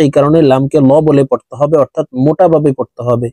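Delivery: ordinary talk, nothing marked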